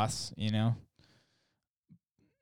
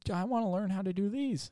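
put-on higher, eager little boy; lightly voiced eager kid; 9 semitones higher than usual. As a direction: ask like a kid begging to try. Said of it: "this kid that I'm with is like".

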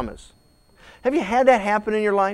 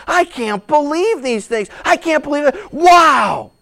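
much higher and louder, amazed; exuberant disbelief; 8 semitones higher, 7 dB louder, slower than usual. Retold or imitated. Believer marveling at God's answered promise, amazed and loud. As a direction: gasp it out in delighted disbelief. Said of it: "And now you're just exuberant".

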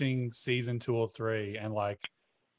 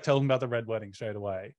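ordinary talk, nothing marked

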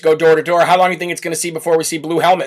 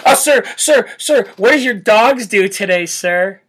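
mock fawning reporter; 2 semitones higher than usual. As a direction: play a fawning reporter asking. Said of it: "mock reporter questions".

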